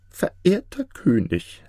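high, slow vizier voice; scheming vizier. Acted. Vizier begins complaint to the king, scheming. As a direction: draw out the address in a high voice.

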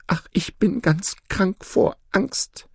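high, slow, whispery lament; frightened goose. Acted. Goose confiding her terror to the peacocks, anxious and high. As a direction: moan it high, sick with fear. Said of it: "rief die Gans".

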